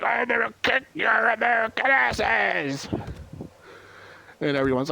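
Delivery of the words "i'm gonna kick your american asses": high, hammed-up heel taunt; trash-talk the crowd like a wrestling villain; mock wrestler taunt. Hitler the heel taunting the American crowd, high hammed-up voice.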